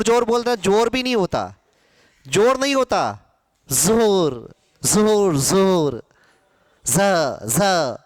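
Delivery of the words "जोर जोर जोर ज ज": जोर said zor, z pressed; जोर with a stressed z; जोर z zor deke; nukta z sound. Shown as a demonstration. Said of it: "इस तरह से इसका उच्चारण".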